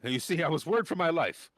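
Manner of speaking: put-on nervous teen gushing thanks; timid boy scout. GM plays the young scout.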